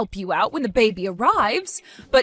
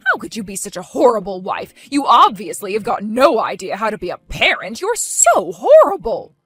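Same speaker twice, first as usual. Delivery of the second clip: furious accusation; 6 dB louder than usual. Acted. Martha accusing Sally of being a horrible wife, furious and loud.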